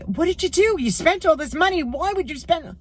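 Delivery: high-pitched mock scolding; retold yelling. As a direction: re-enact telling off a spendthrift husband, pitch way up. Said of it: "I yelled at him so much".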